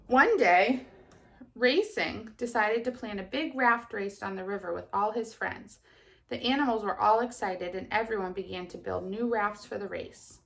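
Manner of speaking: put-on lively storybook reading to children; kindergarten teacher voice. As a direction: read it brightly to little kids. Teacher reads story aloud.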